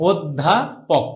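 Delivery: "অধ্যাপক" as od, dha, pok with short gaps; teacher's slow model with closed o; broken-up pronunciation demo. Shown as a demonstration. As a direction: say "অধ্যাপক" syllable by syllable, o first. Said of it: "উচ্চারণ আমি যেমনে করি সেমনে".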